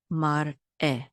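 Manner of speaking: mar eh broken by a clear gap before eh; glottal stop. Shown as a demonstration. Teacher showing the aleph's glottal stop, mar eh split in two. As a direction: stop after mar, then a fresh eh. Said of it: "The aleph is also glottal stop".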